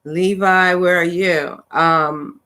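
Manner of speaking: ordinary delivery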